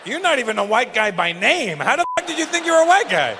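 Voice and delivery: loud, high, incredulous rant; mock outrage. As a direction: berate the audience member at full volume. Mock tirade at an audience member.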